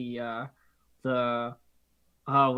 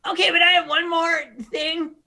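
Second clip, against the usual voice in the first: pleading kobold; 14 semitones higher, 9 dB louder than usual. Acted. Kobold pleads before being struck.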